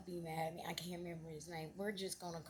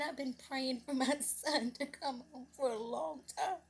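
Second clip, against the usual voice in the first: high, louder emotional outpouring; tearful relief; 6 semitones higher, 5 dB louder than usual. Retold or imitated. Mother telling lady of prayers for her son, tearful. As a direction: pour it out with tears welling.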